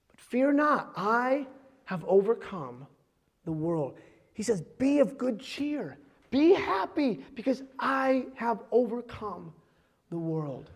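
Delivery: measured reassurance from the pulpit; solemn preaching. Real, not acted. Pastor relaying Jesus's comfort to believers, slow solemn preaching.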